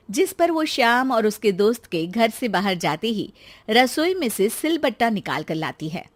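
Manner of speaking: ordinary delivery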